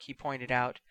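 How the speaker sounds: plain reading voice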